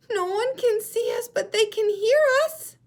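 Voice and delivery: very high, loud penguin voice; a chick comforting the seal with an idea; Little Penguin voice. Shown as a demonstration. Teacher voicing Little Penguin reassuring Baby Seal. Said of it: "show you how to use voices".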